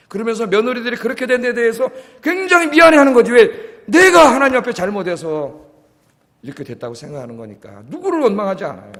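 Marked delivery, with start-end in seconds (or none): none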